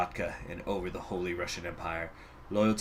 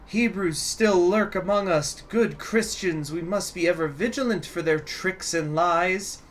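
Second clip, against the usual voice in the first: raised, higher preaching voice of a hateful orator; propagandist radio speech; 8 semitones higher than usual. Acted. Taboritsky's speech over the radio to good Christians, a raised sermon voice. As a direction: rouse the faithful against hidden enemies.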